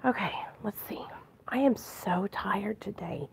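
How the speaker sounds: tired whisper. Real, not acted. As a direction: whisper it, barely there. Quiet house, tired.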